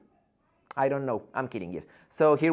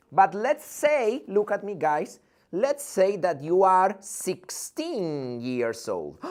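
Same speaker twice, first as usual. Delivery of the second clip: slow, drawn-out build-up; slow dramatic set-up; slow setup; 5 semitones higher, 4 dB louder, slower than usual. Shown as a demonstration. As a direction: stretch it out for suspense. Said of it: "teacher sets up a scenario".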